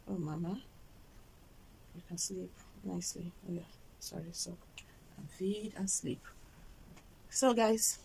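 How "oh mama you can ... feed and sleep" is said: soft lulling. Real, not acted. Mother murmurs to her newborn to sleep, very softly. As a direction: murmur gently so she drifts off.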